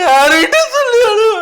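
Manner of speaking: high, loud crying wail; loud weeping cry; wailing sob. Acted. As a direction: break down bawling for the hero. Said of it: "ஏன் அழுவுறீங்க".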